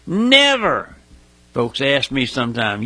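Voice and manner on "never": "Never" cried high, standing alone; underlined never. Real, not acted. Preacher answering what we see today, emphatic high "Never". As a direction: punch "Never" up high, then let it fall. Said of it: "Underline that, folks".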